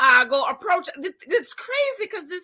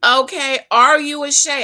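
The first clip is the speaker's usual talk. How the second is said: loud, punchy callout; 10 dB louder than usual. Real, not acted. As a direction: call them out, loud. Calling out viewers.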